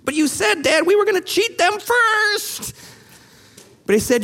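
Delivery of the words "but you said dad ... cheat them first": high, whiny mock-crying son; hammed-up tearful complaint. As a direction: wail it to Dad, stretching the end. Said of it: "Here he's crying".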